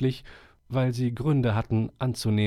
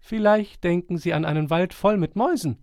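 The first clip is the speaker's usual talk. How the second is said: reserved squirrel; 8 semitones higher, 4 dB louder than usual. Acted. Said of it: "meldete er sich zurückhaltend".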